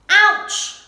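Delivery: sharp, loud, high yelp; cry of pain. Acted. Little Owl landing hard, sharp loud ouch. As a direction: yelp as she hits the ground. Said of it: "cried Little Owl as she hit the ground".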